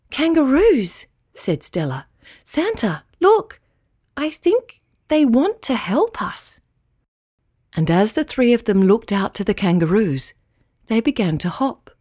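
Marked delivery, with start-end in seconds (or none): 0.1-6.6 put-on higher, eager child voice; excited child. Stella showing Santa the kangaroos, excited. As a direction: urge Santa to look.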